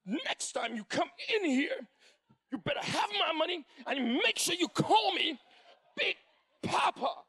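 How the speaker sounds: cocky winner. Retold or imitated. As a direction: lord it over the loser, laughing. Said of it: "George was like".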